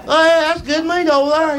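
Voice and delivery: mock offended local.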